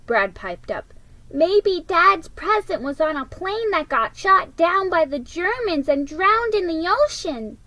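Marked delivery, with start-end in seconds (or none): 1.3-7.6 put-on high, eager little-boy voice. Brad piping up to his family about the Germans, high and eager. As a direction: pipe up like an excited kid.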